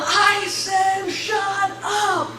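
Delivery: high, drawn-out mock yell; imitated angry shout. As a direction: imitate a demon yelling in irritation. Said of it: "the voice starts shouting".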